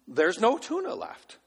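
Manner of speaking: put-on tuna lifted high in a kid's wail; imitated boy; high child whine. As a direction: whine it like the toddler. Child voice repeat.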